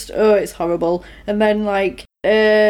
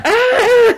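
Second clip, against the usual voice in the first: high, loud comic scream with laughter; imitated horrified shriek breaking into a laugh; mock scream; 14 semitones higher, 5 dB louder than usual. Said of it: "And Adam screams".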